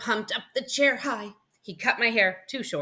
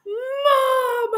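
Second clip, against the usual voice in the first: very high, loud, stretched-out shout; yelling for Mom; 8 dB louder than usual. Acted. Boy spotting another dinosaur, yelling for mom. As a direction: shriek for your mother in excitement. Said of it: "I yelled".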